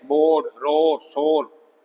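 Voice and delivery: "बोर्ड, रोर, शोर" as separate short-O examples. Shown as a demonstration. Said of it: "short ओ है ये".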